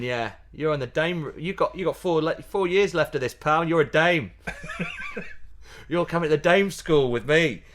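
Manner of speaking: playful needling, a hint of Welsh; teasing with a wink. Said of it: "with a wink in his eye".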